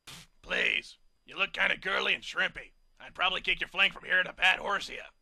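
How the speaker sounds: loud, sneering big-brute voice; scornful bully. A narrator's reading. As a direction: scoff at the small ponies. Hokey Smokes mocks the ponies.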